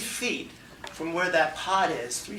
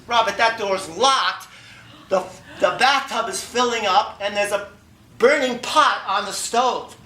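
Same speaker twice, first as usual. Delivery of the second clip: louder, urgent list of disasters; alarmed urgency; 5 semitones higher, 8 dB louder than usual. Retold or imitated. Girlfriend warns him of locked door and burning pot, loud alarm. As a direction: raise the alarm, louder with each item.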